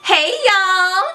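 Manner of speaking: ordinary delivery, nothing marked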